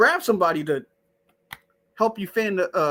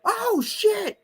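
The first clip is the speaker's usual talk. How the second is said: mock scream; much slower than usual. Streamer imagining a burning victim's reaction, mock screaming. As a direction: imitate someone screaming in panic, voice pitched way up. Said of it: "Who wouldn't be screaming like".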